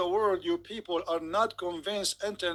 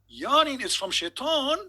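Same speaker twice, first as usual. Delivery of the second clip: louder, higher, slow incredulous question; mock shock; 5 semitones higher, 5 dB louder than usual. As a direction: gasp it out in mock horror.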